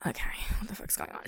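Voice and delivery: hushed whisper to herself. Retold or imitated. Whispering to herself at the date table. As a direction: whisper it so he can't hear.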